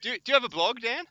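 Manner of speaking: high, loud teasing question; calling out. Real, not acted. Commentator quizzes Dan about having a blog, calling out. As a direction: call it across to your mate.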